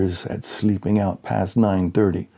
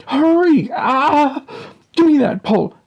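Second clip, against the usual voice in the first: frantic plea; 5 dB louder than usual. Acted. Dad straining, pleading.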